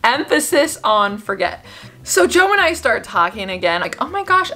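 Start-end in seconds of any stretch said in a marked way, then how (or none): 4.0-4.5 giddy gush. Retold or imitated. Giddy retold thought about Joe.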